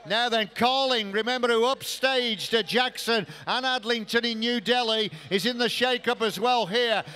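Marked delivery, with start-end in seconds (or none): none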